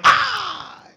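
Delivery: high, drawn-out "ah" of recognition; retold cry of recognition; surprised recognition. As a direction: imitate suddenly recognising an old friend. Retold phone call, friend recognised.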